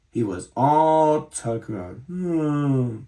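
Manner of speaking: sleepy. A narrator's reading. Sleepy ending of overday.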